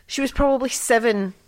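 normal talking voice

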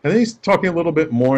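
ordinary delivery

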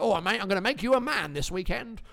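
lightly voiced matey geezer; a hint of a cheeky London lad; Lenny the Geezer. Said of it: "that's my Lenny the Geezer impression".

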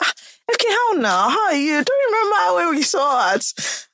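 high, bright mock greeting as she recalls meeting; imitating a chirpy acquaintance. Hairdresser telling friend about overfriendly stranger, high mock greeting. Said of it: "She just came and said".

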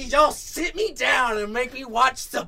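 comic indignant shouting; mock outraged rant. Real, not acted. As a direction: rant at the friends through laughter. Outraged mock rant about friends.

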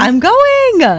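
high, thrilled squeal; real excited squeal. Real, not acted. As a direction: squeal the news with glee. Thrilled about the [name] concert.

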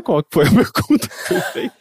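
trying not to laugh. Real, not acted. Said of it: "Eu tô tentando não rir".

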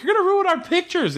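a petulant teen complaining, hammed up; whiny daughter. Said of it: "the daughter being like".

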